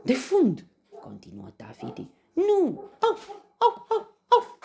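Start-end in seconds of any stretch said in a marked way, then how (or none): 0.0-0.7 loud, high order; commanding shout. A narrator's reading. Tafiti orders the next pinch. 2.3-4.6 very high, loud yelps; pained yelping. A narrator's reading. Lion pinched repeatedly.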